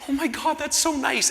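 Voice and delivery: high-pitched breakneck gratitude, retold; gushing thanks. Running late as a man opens the door, gushing high. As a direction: squeal it in mock gratitude.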